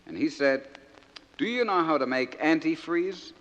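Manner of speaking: naive outport soldier son reading his letter; broad dialect, lively comic recitation; George from Crinkle Cove. Acted. Soldier son writing home to Mama and Dadda, naive and earnest.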